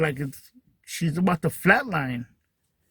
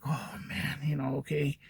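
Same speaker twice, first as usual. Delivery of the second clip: much softer, resigned letdown; deflated sigh; 3 semitones lower, 12 dB quieter than usual. Retold or imitated. Son let down after the doctor's vague answer, soft and resigned. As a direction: let it fall away, resigned.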